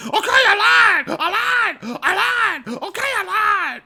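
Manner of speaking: high, shouted, hammed-up confession; mock confession. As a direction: yell it like a cornered kid giving up. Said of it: "he finally comes out and he goes".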